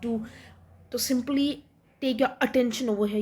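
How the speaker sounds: ordinary talk, nothing marked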